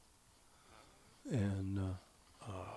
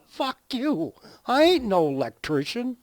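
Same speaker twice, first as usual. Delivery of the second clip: much higher, louder retort; defiant kid shout; 12 semitones higher, 10 dB louder than usual. Retold or imitated. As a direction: yell it back like a bratty boy. Punchline, boy shouts back.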